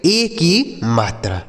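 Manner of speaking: ए की मात्रा spelled out slowly; slow emphatic answer. Shown as a demonstration. Teacher answers his own question for pupils, slow and deliberate. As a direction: draw out ए की मात्रा word by word. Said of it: "क्या होता है मेरे भाई".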